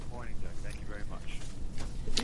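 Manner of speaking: faint, muffled phone line; distant voice down a phone line; over a landline phone. Real, not acted. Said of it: "we can hardly hear you".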